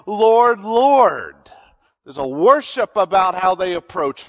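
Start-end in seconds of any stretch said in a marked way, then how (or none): 0.1-1.3 loud, fervent cry to the Lord. Retold or imitated. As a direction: call out with raw emotion. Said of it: "emotion attached to their crying out".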